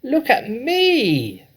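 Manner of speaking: put-on very high, slow, loud old woman's cry; a jealous grandma calling for attention; Grandma shouting. A narrator's reading. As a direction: demand attention from the rooftop. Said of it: "Grandma shouted from the rooftop".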